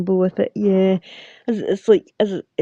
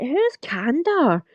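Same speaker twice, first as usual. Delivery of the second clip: high-pitched, drawn-out puzzled question; mock-baffled, slow and swooping high; retold bafflement; 9 semitones higher, much slower than usual. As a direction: imitate your own baffled reaction, pitched way up. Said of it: "was like".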